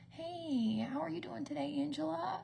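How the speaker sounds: high, soft singsong hello; lilting, hushed over-familiar greeting; sweet mock greeting. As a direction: coo it softly, like you know them. Acting out greeting a name-tagged stranger.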